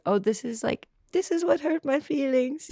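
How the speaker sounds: put-on small, high-pitched little-kid voice; baby voice. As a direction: slip into a high baby voice to own up to hurt. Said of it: "I have to do it in a voice".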